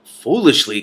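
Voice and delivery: loud scoffing echo; snorting scorn. Acted. Kazul mocking Simrine's mild word, scornful. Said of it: "Kazul snorted".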